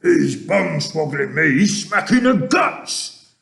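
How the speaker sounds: high, indignant giant shout. A narrator's reading. Kicked giant waking up, shouting. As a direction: shout it, climbing in pitch. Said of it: "shouted the gizzard gulper".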